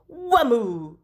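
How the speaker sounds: usual speaking voice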